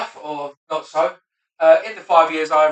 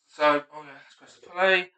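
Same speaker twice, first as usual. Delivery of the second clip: ordinary talk, nothing marked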